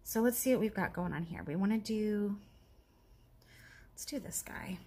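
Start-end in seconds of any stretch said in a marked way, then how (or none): 3.9-4.8 soft whisper. Real, not acted. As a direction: whisper to yourself. Whispering to herself while crafting.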